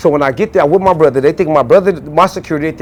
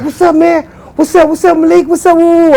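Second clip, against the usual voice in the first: high, louder hype greetings; eager greetings; 13 semitones higher, 5 dB louder than usual. Retold or imitated. Studio crew greeting Malik, high and excited. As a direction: crowd welcoming star.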